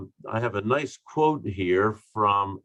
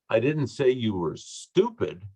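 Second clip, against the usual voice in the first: emphasis on "stupid", as if another word was meant; stress on STUPID; 3 semitones higher than usual. Shown as a demonstration. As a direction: save the punch for the last word, "stupid". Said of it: "based on what I emphasize".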